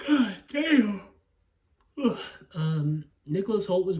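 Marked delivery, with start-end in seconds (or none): 0.1-2.2 loud exclamation. Real, not acted. Exclaiming over an actor.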